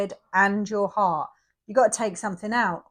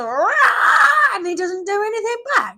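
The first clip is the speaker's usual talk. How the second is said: mock roar; 11 semitones higher, 10 dB louder than usual.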